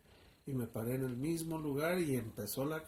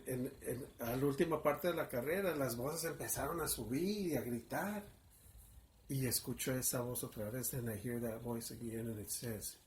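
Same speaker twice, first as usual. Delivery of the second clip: ordinary delivery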